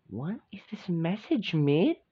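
usual speaking voice

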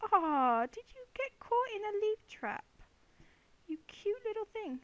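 high-pitched cooing over a cute animal; cooing baby talk. Pretend. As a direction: coo at a trapped little piggy. Cooing at a trapped pig.

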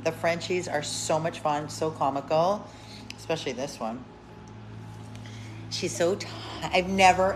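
ordinary delivery

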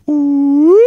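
siren ooh up, down, up; siren wail. Shown as a demonstration. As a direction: trace an N with a siren. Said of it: "let's do the letter N again".

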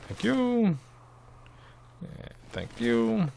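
high, drawn-out thank you; sing-song thanks. Real, not acted. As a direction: coo it sweetly, stretching it out. Thanks the game character mockingly.

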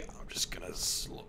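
hushed whisper. Real, not acted. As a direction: breathe it out under your breath. Sneaking into dark room.

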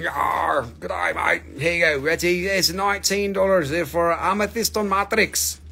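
hammed-up English-Australian accent; loosely faked Aussie-English accent. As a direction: slip into a mock Australian twang. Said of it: "my English Australian accent".

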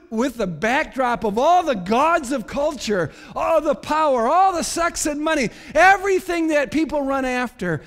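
high, loud mounting list; forceful preaching. Real, not acted. Preacher lists what people run after, loud and impassioned. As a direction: pile up each item with rising force. Preacher piles up worldly gods.

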